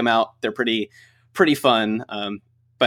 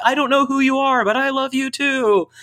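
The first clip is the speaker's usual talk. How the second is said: playful yell back; 13 semitones higher than usual. Retold or imitated. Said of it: "And I'm like".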